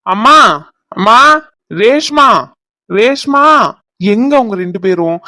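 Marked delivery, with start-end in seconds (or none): 0.1-3.4 urgent, raised-voice calls for family; calling out. Acted. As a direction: call out through the house, searching. Calls out for mother and Reshma. (கூப்பிட்டுத் தேடும் குரல்)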